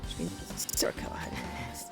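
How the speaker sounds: whisper. Real, not acted. Mock secret aside.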